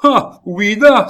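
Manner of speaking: very high, loud, slow scornful cry; mocking outcry. Baron scoffing at Gilbert's protectors, loud mocking cry. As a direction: scoff at his boast at full voice. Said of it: "s'écria le baron".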